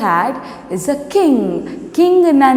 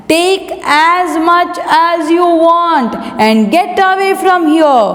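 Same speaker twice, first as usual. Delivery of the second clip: high-pitched, irritated king; acted royal dismissal; angry dismissal; 7 semitones higher than usual. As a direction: send them off as an annoyed king.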